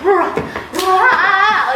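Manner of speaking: high, loud playful roar; mock roar. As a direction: let out a silly wild roar. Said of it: "like that".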